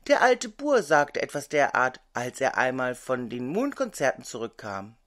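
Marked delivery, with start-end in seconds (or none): none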